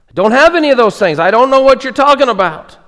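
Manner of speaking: high, loud, fast flustered protest; overwhelmed thief. Acted. Thief protesting to the quizzing angels, high and flustered. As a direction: blurt it out, totally lost.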